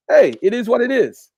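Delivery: normal talking voice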